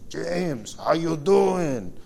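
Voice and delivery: retelling the greeting in a put-on Romanian accent. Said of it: "in his thick Romanian accent".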